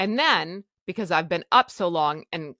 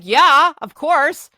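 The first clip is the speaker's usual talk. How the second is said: loud, high "Yeah, of course!" as if obvious; emphatic yell; 7 semitones higher, 13 dB louder, slower than usual. Retold or imitated. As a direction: blurt it out, louder and higher. Retold reply to doctor.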